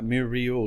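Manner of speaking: "mural" stretched to myoor-ee-ul; three syllables in "mural", with an added "ee"; extra syllables. Shown as a demonstration. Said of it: "like, with more syllables".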